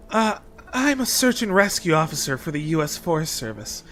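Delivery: lightly voiced anime protagonist; a hint of an earnest hero's resolve; earnest determined anime hero. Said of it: "an anime protagonist voice the entire time".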